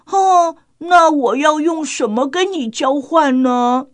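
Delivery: put-on a grateful little boy asking; child voice. Grateful Xiaobai asks Ani.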